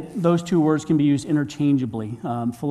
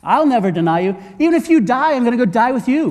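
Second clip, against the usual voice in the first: loud, high bragging promise; big-mouth boast; 7 semitones higher, 5 dB louder than usual. Retold or imitated. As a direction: crow it like a cocky loudmouth. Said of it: "the big mouth who said".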